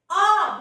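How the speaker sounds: आ long, pushed up from the stomach; आ from the belly. Shown as a demonstration. Teacher has pupils try it, आ from the belly. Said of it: "पेट के अंदर से आवाज".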